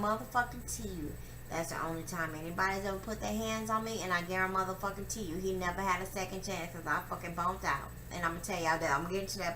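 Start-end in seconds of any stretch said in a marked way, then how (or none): 4.0-5.4 fast, run-together vow; quick emphatic repeat; rushed vow. Real, not acted. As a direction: rattle it off quickly.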